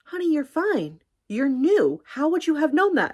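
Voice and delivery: high-pitched motherly reassurance; motherly coworker. Acted. Mom-like coworker excusing a newcomer's mistake, motherly. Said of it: "very much my mom".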